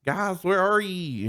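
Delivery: high, drawn-out call into the dark; calling out lost. Retold or imitated. Thrown in a dumpster, calling for his friends, high and drawn out. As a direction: call out for friends you can't find, slow and high.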